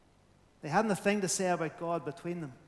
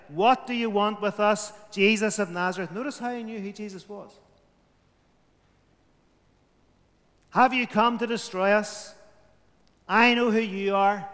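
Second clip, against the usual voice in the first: louder, defiant demon's challenge; a hint of menace in a raised cry; 3 semitones higher, 5 dB louder than usual. Preacher voicing the possessed man, defiant and menacing. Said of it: "Defiance screamed ... with a hint of menace".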